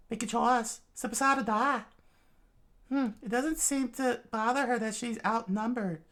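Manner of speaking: high, cold demand; high and curt; high character voice. Acted.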